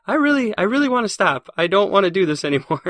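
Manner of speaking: high, strained mock-tearful pleading, pitched well up; half-laughing imitation of begging to stop mid-race; retold crying plea. Runner retelling to his girlfriend, mock-tearful high plea. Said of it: "I cried at mile eighteen".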